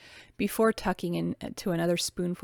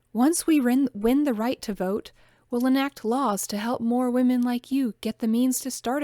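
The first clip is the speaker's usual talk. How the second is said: louder, higher, pressing each point; insisting; 5 semitones higher, 5 dB louder than usual. A narrator's reading. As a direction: push the argument home with conviction. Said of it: "Grace insisted".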